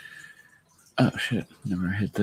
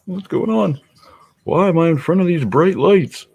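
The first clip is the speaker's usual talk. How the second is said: high, loud startled puppy voice; 13 semitones higher, 8 dB louder than usual. Pretend. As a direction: play the dazed pup squinting at the lights. Voicing the puppy's thoughts.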